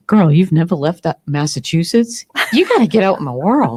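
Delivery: lightly voiced nudging friend; a hint of a bossy friend. Friend urging teller to leave Massachusetts, lightly voiced pushy tone. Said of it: "She said to me".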